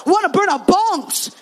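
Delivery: acted emphatic boxer; insistent repeat. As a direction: repeat it loudly for someone who missed it. Boxer repeats for puzzled pastor.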